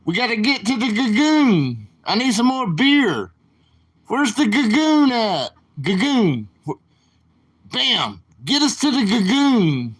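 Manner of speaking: put-on high, slurred, worked-up drunk voice; Don Vito impression. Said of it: "Don Vito kept saying".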